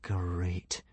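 soft, low, deadpan; dismayed. A narrator's reading. Hiccup learns he has a cut, quietly dismayed. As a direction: drop the voice in resignation.

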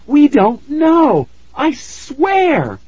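high, slow, desperate plea; frightened pleading. Acted. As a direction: beg high and shaky.

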